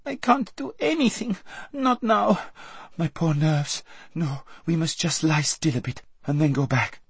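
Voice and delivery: put-on very high squeaky girl; a spoiled girl squeaking in protest; squeaked. A narrator's reading. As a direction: squeak it out in dismay. Lazareline refuses, frightened.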